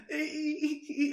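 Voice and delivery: high, thin dolphin squeaks; mock dolphin chirps. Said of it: "he starts going".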